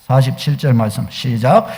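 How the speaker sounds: ordinary delivery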